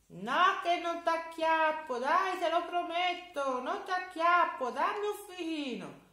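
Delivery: high, loud, pleading giant; a hungry storybook giant begging sweetly. Acted. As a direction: giant coaxing the boy for a fig. Giant voice held.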